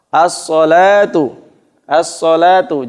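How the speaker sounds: As-salatu with the lam silent, s doubled; lam not pronounced. Shown as a demonstration. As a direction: say As-salatu, lam swallowed into sad. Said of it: "lamnya tidak dibaca".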